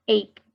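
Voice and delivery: "ache" said with a K sound for ch; teacher's demo of "ache", ending in K; ache pronunciation. Shown as a demonstration. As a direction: model "ache" as "ake". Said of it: "lo vamos a pronunciar como".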